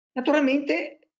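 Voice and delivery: sudden loud sneeze; sneezing. Real, not acted. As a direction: sneeze mid-sentence. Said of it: "per lo starnuto".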